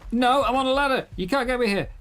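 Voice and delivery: high, loud indignant panic; panicked protest. Real, not acted. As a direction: protest loudly, half laughing. Stuck on ladder, being attacked.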